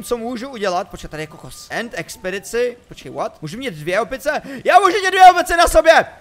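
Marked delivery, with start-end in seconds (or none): 4.6-6.1 very high, loud squeal of delight; excited shout. Real, not acted. Player finding he can carry two monkeys, excited. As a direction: shriek it out, thrilled by the discovery.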